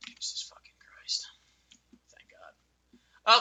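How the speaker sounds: whispered relief; exasperated whisper. Real, not acted. Gamer reacting to annoying quest, whispered relief. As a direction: mutter it under your breath.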